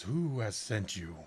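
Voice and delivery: slow, grave ancient dragon. Acted. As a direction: speak as a huge gold dragon. Gold dragon asks, far timbre.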